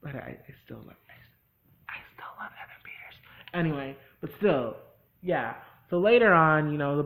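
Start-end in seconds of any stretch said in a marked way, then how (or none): 0.0-3.2 whispered confession. Real, not acted. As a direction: drop to a secret whisper. Confessing a guilty crush.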